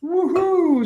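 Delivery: happy high whoop; excited whoop. Real, not acted. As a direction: cheer it out. Cheering at the food.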